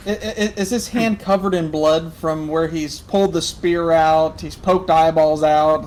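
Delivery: loud, drawn-out, laugh-tinged question; real incredulous glee at full volume; loud amused questioning. Real, not acted. GM gleefully questions a gory handshake.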